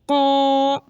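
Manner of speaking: क़ा as a held, rounded "qaw"; heavy qaf. Shown as a demonstration. Teacher drilling learners, full-mouthed "qaw". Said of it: "इसको भी मोटा पढ़ना है".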